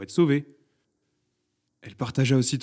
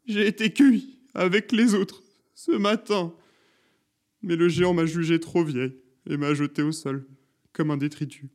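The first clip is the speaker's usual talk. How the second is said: put-on high plaintive old woman; high lament, sinking towards the end; on the verge of tears; 7 semitones higher than usual. A narrator's reading. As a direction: wail the tale of being cast aside. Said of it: "Elle était alors au bord des larmes".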